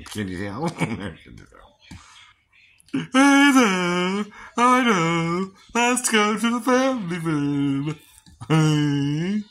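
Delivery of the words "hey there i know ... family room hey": higher, louder put-on voice; impression. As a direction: do a character voice, pitched up. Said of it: "Even Seth does that one".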